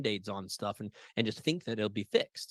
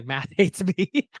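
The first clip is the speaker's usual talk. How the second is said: laughing; 7 semitones higher, 8 dB louder, much slower than usual. Real, not acted. Audience member laughing.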